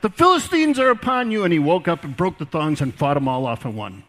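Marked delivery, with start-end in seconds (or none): 0.0-1.4 loud, high cry of alarm; cried out. Retold or imitated. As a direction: call out the warning. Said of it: "she cried out".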